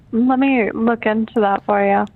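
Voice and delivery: usual speaking voice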